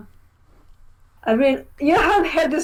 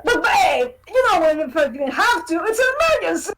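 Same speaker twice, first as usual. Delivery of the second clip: 1 Cameron's line; 7 semitones higher than usual. Retold or imitated. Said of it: "I really like Cameron's".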